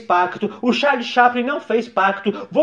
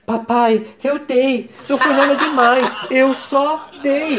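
put-on girl's voice; 4 semitones higher, slower than usual. Daughter voiced in skit.

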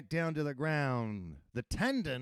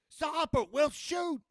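police shout; 13 semitones higher, 4 dB louder than usual. A narrator's reading. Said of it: "they uttered".

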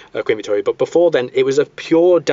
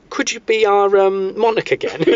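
sheepish plea; 8 semitones higher than usual. Retold or imitated. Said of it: "invited him back and said".

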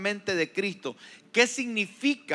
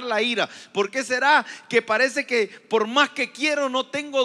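louder, higher fervent sermon questions; preaching at full force; 4 semitones higher, 4 dB louder than usual. Acted. Pastor firing questions about anger at the congregation, fervent and loud. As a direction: drive each question home to the congregation.

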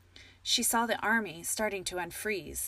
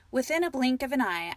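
ordinary delivery, nothing marked

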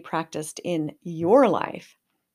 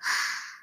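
big sharp breath into the microphone; deep breath in; 4 dB quieter than usual. Shown as a demonstration. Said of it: "show me how to take deep breaths".

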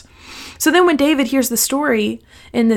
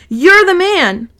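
higher, louder accusing cry; accusing exclamation; 8 semitones higher, 6 dB louder, slower than usual. Acted. Nathan confronting King David, a higher, louder accusing cry. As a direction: point the finger and call it out.

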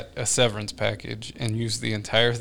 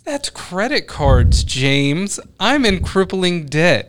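slow, high, loud mock outburst; hammed-up exasperated confession; mock exasperation; 7 semitones higher, 5 dB louder, much slower than usual. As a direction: stretch it out in playful indignation. Mock outburst about money troubles.

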